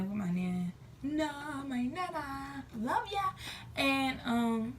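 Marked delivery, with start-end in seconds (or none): 1.0-3.3 affectionate shout-out. Real, not acted. Shout-out to her nana.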